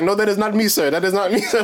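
high, laughing mock denial of the scammer; scammer denying. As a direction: play the caught scammer pleading innocence through giggles. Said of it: "He's like".

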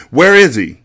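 loud, high furious demand; yelled question. A narrator's reading. Mecca raging at Mati on the floor, yelling. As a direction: yell it in rage. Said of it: "he yelled".

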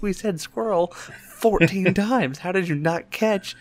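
mocking sister.

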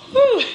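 high emotional exhale; shaky sigh. Real, not acted. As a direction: blow out a breath, holding back tears. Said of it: "I'm trying not to cry".

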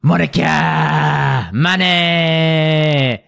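long, stretched, loud MC call; drawn-out hype shout. Retold or imitated. Imitating the drunk wedding DJ, long stretched hype call. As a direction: drag the names out like a drunk DJ. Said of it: "longing it out longer and longer".

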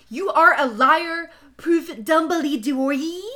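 high, loud, slow accusation; hammed-up angry outburst; accusing. A narrator's reading. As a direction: draw out the charge theatrically. Acted quarrel in the story.

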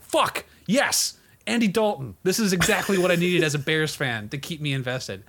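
high-pitched, sarcastic fan celebration; mock elation. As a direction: play an overjoyed fan, dripping with sarcasm. Acts out imaginary delighted fan, sarcastically.

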